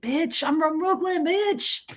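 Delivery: high, shouted mock Brooklyn swagger; mock Brooklyn tough guy. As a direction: bark it like a cocky Brooklyn guy showing off. Chiropractor boasting, acted mock quote.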